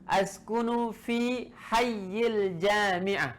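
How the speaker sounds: slow, deliberate model reading; every syllable drawn out clearly; careful slow reading. Shown as a demonstration. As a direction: read it out slowly for the class to copy. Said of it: "Ingat cara bacanya".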